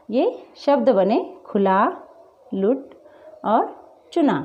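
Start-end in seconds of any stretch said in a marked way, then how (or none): 1.5-4.3 short, light u in "खुला", "लुट", "चुना". Shown as a demonstration. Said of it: "कम वज़न दिया जा रहा है". ("खुला, लुट और चुना" में छोटा उ)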